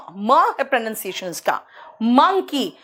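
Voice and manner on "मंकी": मंकी as "MUN-kee"; short "uh" stressed in मंकी; correct pronunciation. Shown as a demonstration. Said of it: "इसको मंकी नहीं कहते". (मंकी में छोटा "अ")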